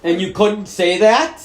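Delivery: loud, high, slow outrage; angry shout. Real, not acted. Son shouting at his mother in disbelief.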